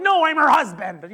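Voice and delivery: high, loud, through laughter; laughing correction. Retold or imitated. Husband laughing as he corrects the nurse. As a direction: correct her while cracking up. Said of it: "I'm like".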